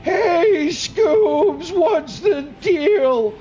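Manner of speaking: very high, loud, drawn-out falsetto; slow wailing call at the top of the voice; high cartoon shout. Shown as a demonstration. Player showing off voices to viewers, high cartoon shout.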